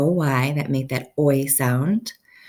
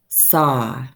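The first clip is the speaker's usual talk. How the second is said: normal talking voice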